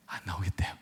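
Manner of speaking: retelling a sulky daughter in a whisper. Retold or imitated. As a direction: whisper the refusal under your breath. Daughter refuses to come out.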